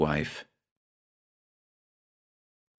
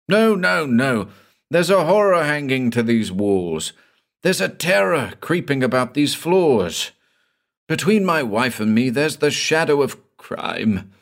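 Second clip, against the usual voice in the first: anguished outburst; 6 semitones higher than usual. Acted. Husband's anguished outburst of horror.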